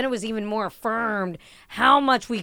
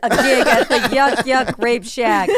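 loud, higher teasing through laughter; laughing tease; 3 semitones higher, 8 dB louder than usual. Real, not acted. Friend mocking a comedian about the club gig, laughing. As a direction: tease them while cracking up.

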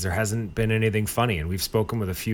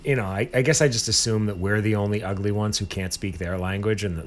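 ordinary talk, nothing marked

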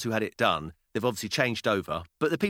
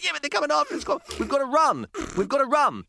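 put-on urgent child; 14 semitones higher than usual. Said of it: "She said".

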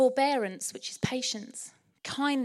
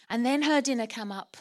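usual speaking voice

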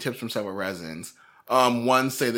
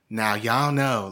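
slow Texas drawl; slower than usual. Retold or imitated. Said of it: "they have that longer drawl".

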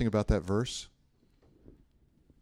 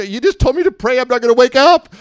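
put-on scared kid; 14 dB louder than usual. Kid scared by bedtime prayer.

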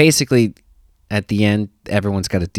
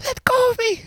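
put-on screaming kid. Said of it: "He's like".

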